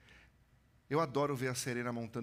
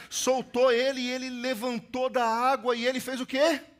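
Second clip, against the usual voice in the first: climbing higher and louder through the drowning scene until nearly shouting; building dramatic telling; 8 semitones higher, 8 dB louder than usual. A narrator's reading. Preacher retelling the drowning to the congregation, building to a near shout. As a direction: push the pitch and volume up line by line as the student runs out of air. Drowning story told at rising intensity.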